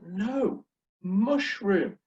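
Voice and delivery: high-pitched, insistent "No, mushroom"; retold correction. Correcting the server a second time.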